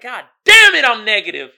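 loud comic self-exasperation; hammed-up frustrated holler; exasperated outburst. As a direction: wail it in mock despair, cracking up. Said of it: "you're like".

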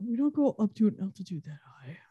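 a husband lecturing his wife, quick and snide; smug condescension. Retold or imitated. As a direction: tosses off the correction like she's an idiot. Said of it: "he said it in such a dick way".

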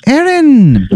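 Irish brogue calling out, very high; Irish accent. Acted. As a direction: cry the name in a squeaky Irish accent. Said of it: "I can't do the accent".

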